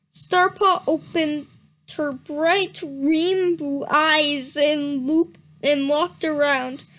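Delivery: ordinary talk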